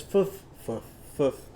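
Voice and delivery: "Foof" blown through teeth on lip; f sound bark. Shown as a demonstration. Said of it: "It would sound like this".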